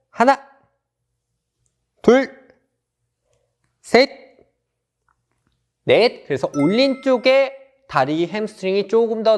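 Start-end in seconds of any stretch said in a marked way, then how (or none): 0.1-6.3 loud counting reps; barking gym coach. Acted. Coach counting dynamic stretch. (헬스 코치처럼 크게 구령)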